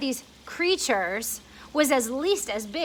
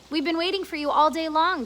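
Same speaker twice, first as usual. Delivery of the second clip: grasshopper welcome; 5 semitones higher, 3 dB louder than usual. Acted. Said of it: "the old green grasshopper said".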